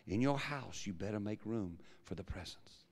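usual speaking voice

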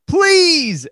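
high, loud pleading shout; retold desperate yell; yelled plea. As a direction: yell it out begging, imitating him.

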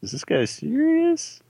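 can't believe this guy; incredulous. Acted. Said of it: "kinda like".

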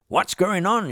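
high-pitched alarmed shout; acted high call of alarm. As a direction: shout it as the third pilot. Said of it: "shouted the jump seat captain".

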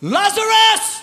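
high, slow, loud call of Lazarus; Jesus calling into the tomb, loud. Acted. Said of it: "He said it in a loud voice".

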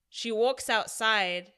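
ordinary talk, nothing marked

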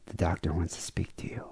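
hushed whisper, no voice. Retold or imitated. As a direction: whisper it like bad news is coming. Receptionist hands call to doctor.

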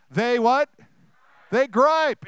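rally the crowd, punch the word gripe. Shown as a demonstration. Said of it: "let's try it again".

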